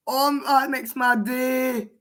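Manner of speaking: ordinary talk, nothing marked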